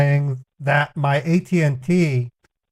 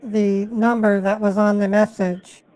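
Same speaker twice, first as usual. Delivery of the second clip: put-on caller voice; 5 semitones higher than usual. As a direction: play an innocent caller, pitched up. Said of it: "I have to literally pretend".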